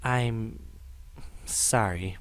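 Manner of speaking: small, low, soft voice; hushed, halting apology; subdued apology. Acted. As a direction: apologize quietly, with a pause midway. Upset character apologizes while comforted.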